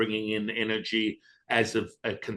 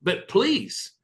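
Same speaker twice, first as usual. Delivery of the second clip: higher begging tone; higher, louder begging please; pleading; 12 semitones higher, 6 dB louder than usual. Retold or imitated. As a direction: plead with the teacher. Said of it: "I'm like".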